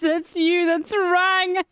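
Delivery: high, slow, cracking up; squealing with amusement; laughing quote. Retold or imitated. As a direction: repeat the punchline through real laughter. Streamer quotes punchline while laughing.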